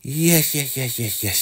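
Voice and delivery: high woman's voice giggling through repeated yeses; giddy laughing yes. Retold or imitated. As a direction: gush it out, giggling like a smitten girl. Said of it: "She's like".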